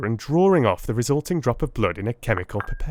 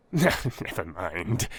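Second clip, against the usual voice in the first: chuckling; 6 semitones lower, 4 dB quieter, much slower than usual. A narrator's reading. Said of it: "said he, chuckling to himself".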